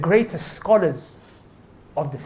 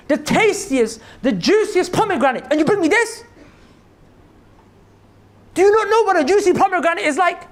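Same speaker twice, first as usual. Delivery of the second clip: scolding master; 8 semitones higher, 3 dB louder than usual. Retold or imitated. The rich master berates his gardener over the sour fruit, indignant.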